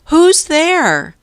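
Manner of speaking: high, loud call into the woods. A narrator's reading. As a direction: call out to the hidden stranger. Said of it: "he called".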